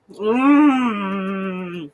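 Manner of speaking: ordinary talk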